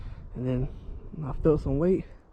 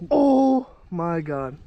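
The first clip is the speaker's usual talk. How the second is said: loud, slow amazed exclamation; stunned at the catch; 7 dB louder, much slower than usual. Real, not acted. Sees the big fish.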